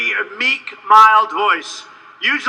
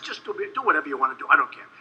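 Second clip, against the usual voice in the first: dismissive brush-off; 7 semitones lower, 9 dB quieter, much faster than usual. Retold or imitated. Brushing off friend on phone.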